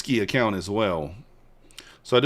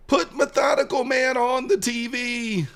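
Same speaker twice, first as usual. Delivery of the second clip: much higher, slow whiny demand; mock demand; 3 dB louder, slower than usual. Fans he sends to the channel, demanding in a high whiny voice. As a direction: chant it like a petulant fan. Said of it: "go over there and say".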